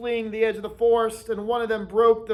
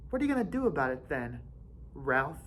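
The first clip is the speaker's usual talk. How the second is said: soft, softer mocking challenge; quiet taunt; 3 semitones lower, 10 dB quieter, faster than usual. A narrator's reading. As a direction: dare him softly.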